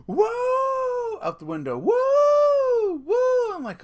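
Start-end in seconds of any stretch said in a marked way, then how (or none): 0.1-1.2 high, drawn-out "whoo"; imitated victory whoop. Fleeing man hollering out truck window, imitated victory whoop. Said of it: "like he won some sort of victory". 1.8-3.5 very high, loud, long whoops; imitated victory whoops. Departing driver celebrating his victory, imitated loud whoops. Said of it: "like he won some sort of victory".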